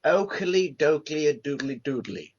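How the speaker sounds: slow, put-on English character voice; English bloke. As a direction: draw out each silly syllable. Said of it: "The English bloke just goes".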